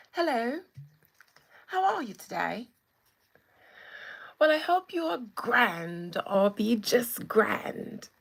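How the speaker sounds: loud, theatrical British greeting; mock British accent. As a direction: ham up a grand British accent. Playful mock-British greeting to a viewer.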